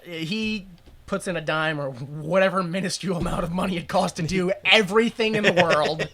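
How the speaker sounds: ordinary delivery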